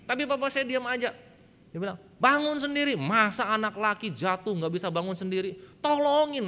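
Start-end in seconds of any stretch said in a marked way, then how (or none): 2.2-5.6 loud, raised-pitch retold rebuke; stern fatherly scolding. Father to fallen boy who won't get up, scolding. 5.8-6.5 high, loud mock-crying child; imitated tearful wail. Small child crying for father's help, mock tearful. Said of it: "nangis Bapak Ibu sekalian, anak kecil begitu ya".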